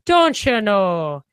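slow, drawn-out Irish brogue. Shown as a demonstration. Showing the host what a brogue is, Irish accent. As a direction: put on a lilting Irish brogue. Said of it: "A brogue is that Irish".

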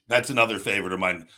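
ordinary delivery

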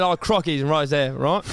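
mock Australian. Shown as a demonstration. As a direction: play the Aussie speech therapist, only half committed. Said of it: "you gotta say".

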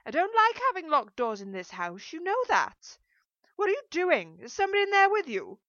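loud, high-pitched interrogation; scolding shout. A narrator's reading. Mother suspects someone is in daughter's room, shouting. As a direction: scold at full voice through a door.

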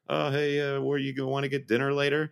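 mock post-seminar chit-chat, lifted in pitch; chatty seminar-goer. Players mingling like seminar attendees, chatty imitation. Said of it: "everyone gets up from their seats and is like".

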